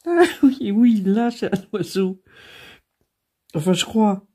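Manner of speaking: high, loud, giggly; barely holding back laughter; laughing. Real, not acted. Fou rire, se trompe d'image. (en plein fou rire, voix haute)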